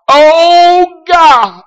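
high, loud, tearful wail; a lost son crying out over his mother's grave; crying out to God. Retold or imitated. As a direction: cry out to God in despair. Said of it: "I cried out".

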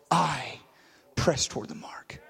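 "I" held apart with a pause after it; stress on I. Shown as a demonstration. Preacher repeating his text to the church, stress on "I". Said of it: "put an emphasis on the word I".

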